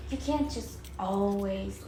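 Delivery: normal talking voice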